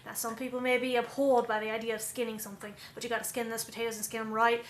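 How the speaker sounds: brisk Australian wildlife-documentary narration; Australian crocodile hunter. Acted. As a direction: narrate like an Australian outback guide stalking rare wildlife. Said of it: "crocodile hunter or like an Australian outback".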